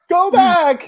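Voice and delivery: high-pitched joking yell; mock shout. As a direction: shout it in jest. Mock protest in retelling.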